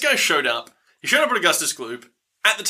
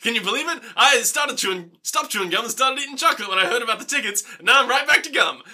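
higher-pitched, hammed-up boasting; bragging little girl; 6 semitones higher than usual. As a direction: play an overexcited kid champion showing off. Playing the gum-obsessed bragging girl.

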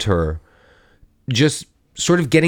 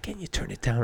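near-silent mouthed words; just mouth it, no sound; 13 dB quieter than usual. Retold or imitated. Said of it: "Alex mouthed".

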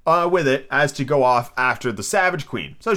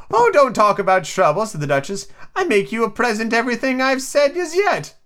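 Duchess voice; 10 semitones higher, 3 dB louder than usual. Acted. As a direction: hold the Duchess's high sing-song. Duchess voiced in read story.

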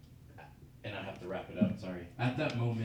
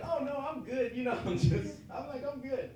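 much higher, breezy put-on reassurance; fake cheerful brush-off; 3 dB louder than usual. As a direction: pretend everything's fine, pitched way up. Hiding shock from cousin on phone.

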